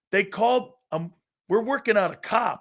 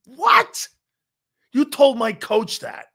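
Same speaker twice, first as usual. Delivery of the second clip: high, loud shriek of disbelief, slowing down; a squeaky what, then a slow outraged question; mock shocked outburst; 7 semitones higher, 5 dB louder, slower than usual. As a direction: play the stunned grandson hearing the betrayal. Reenacts shock at grandfather.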